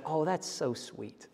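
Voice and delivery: quiet, laughing through the words; mock his wife's touched giggle; lightly imitating a wife's soft laugh. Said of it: "And she said".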